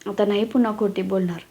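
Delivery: usual speaking voice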